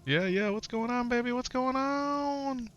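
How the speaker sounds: high, drawn-out hype greeting. Real, not acted. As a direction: call out a big slow welcome.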